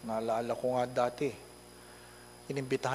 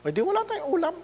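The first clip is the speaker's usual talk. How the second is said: mother's retort; 5 dB louder, much faster than usual. Retold or imitated. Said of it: "Ano sagot ng nanay?".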